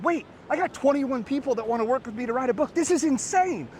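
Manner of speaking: the realization hits, building to a squeal; excited disbelief. Retold or imitated. Realizing the failed ad brought in twenty-one new leads, rising excitement.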